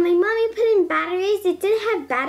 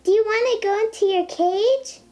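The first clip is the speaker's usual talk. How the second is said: ordinary talk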